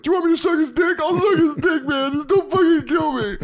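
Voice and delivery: high, shouted desperate pleading; MacGruber begging. Retold or imitated. MacGruber begging his captor not to kill him, high shouting. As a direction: shriek like a cowardly hero begging. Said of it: "my favorite joke in MacGruber".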